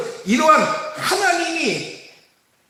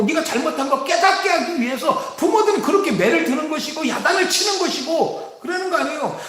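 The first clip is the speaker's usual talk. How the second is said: ordinary delivery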